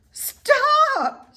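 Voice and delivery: very high, loud shriek; scream. A narrator's reading. Wilbur screams at the sheep to stop. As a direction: shriek to cut her off. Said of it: "screamed Wilbur".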